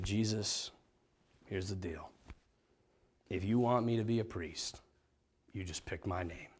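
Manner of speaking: very soft, low bargaining with God; quiet, low, earnest plea; hushed private prayer. Retold or imitated. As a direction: murmur a secret deal to Jesus, head down.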